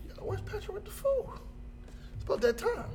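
high, soft impatient wondering. Retold or imitated. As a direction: ask it up high, getting antsy. Impatient waiting for food.

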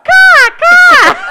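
high shrieking crow caw; shrill screeching bird woman. Pretend. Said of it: "He's like".

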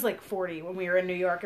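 ordinary talk

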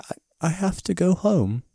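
put-on high, slow British-accented Dorothy. Dorothy turns down Ozma's offer to stay, British accent. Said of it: "I don't know why she turned British".

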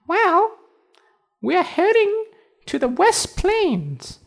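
very high tiny cricket voice. Acted. Cricket answers.

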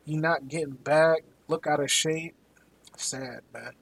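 ordinary delivery

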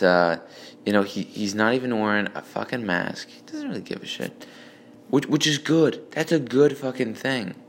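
ordinary talk

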